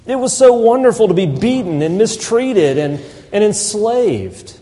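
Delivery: sarcasm. Acted. Sarcastic praise of slavery in Egypt.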